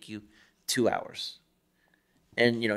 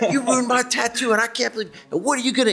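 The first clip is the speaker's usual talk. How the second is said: mock customer screaming; 13 semitones higher, 7 dB louder than usual. Angry customer screaming in the tattooer's face over a ruined tattoo, loud high yell. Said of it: "screaming in somebody's face".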